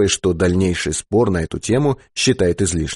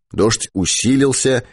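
plain reading voice

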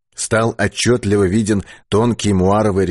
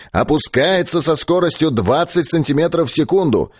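high, raised loudspeaker report voice; radio operator reporting; 6 semitones higher than usual. Acted. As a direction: relay coordinates over the radio, pitched up. (высокий голос радиста, докладывающего по репродуктору)

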